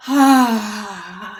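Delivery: one last loud "ah" sigh; deep sighing release. Shown as a demonstration. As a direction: let the whole breath out through sound. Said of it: "deep sort of release through sound".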